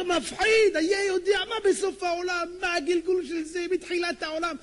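high, loud, drawn-out proclamation; full-force preacher's exclamation; awestruck preaching. Real, not acted. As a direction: cry out in fearful wonder.

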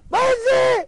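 very high, startled cry; astonished exclamation. Acted. Onlooker seeing rich man enter hovel, astonished cry. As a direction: shoot the pitch right up in amazement. Acted astonishment at upside-down world.

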